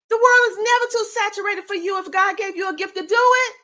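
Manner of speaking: normal talking voice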